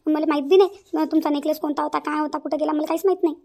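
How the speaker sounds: high, loud, worried mother-in-law; upset mother-in-law. Acted. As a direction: explain the loss with rising alarm. (घाबरलेली सासू, उंच आणि मोठ्या आवाजात)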